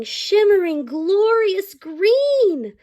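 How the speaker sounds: ordinary delivery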